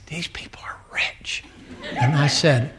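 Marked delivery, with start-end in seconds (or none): 0.1-1.4 secret whisper to dad. Retold or imitated. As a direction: whisper it in awe, not to be overheard. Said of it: "I whispered at him".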